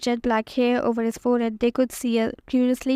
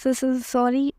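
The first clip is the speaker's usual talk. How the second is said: ordinary delivery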